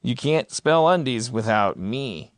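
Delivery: normal talking voice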